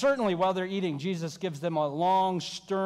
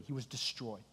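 hushed grief; 7 semitones lower, 11 dB quieter than usual. Real, not acted. Grief over Peter.

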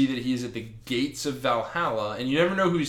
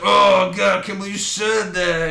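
loud, higher, hammed-up offence; mock outraged cry; 6 semitones higher, 7 dB louder than usual. Offended god answering Frank's taunt, loud mock outrage. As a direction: blurt it out like an insulted god.

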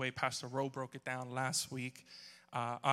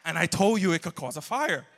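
apocalypse mom; 4 semitones higher, 10 dB louder than usual. Retold or imitated. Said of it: "apocalypse mom".

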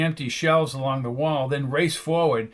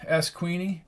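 plain reading voice

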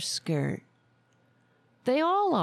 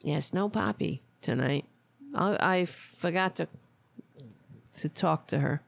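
normal talking voice

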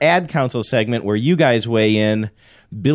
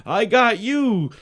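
kid playing with toys; 10 semitones higher than usual. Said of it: "they're moving them around and saying".

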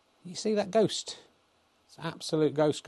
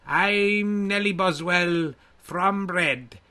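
much higher, slow drag-dame voice; Mrs Brown voice; 4 semitones higher, 3 dB louder, much slower than usual. Acted. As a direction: put on a daft old Irish mum. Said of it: "Can you do Missus Brown's voice?".

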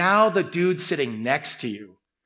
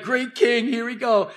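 mocking; 7 semitones higher than usual.

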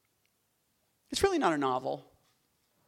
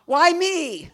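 higher and louder, mock self-pity; mock whine; 10 semitones higher, 10 dB louder than usual. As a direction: play the put-upon victim. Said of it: "it was just like".

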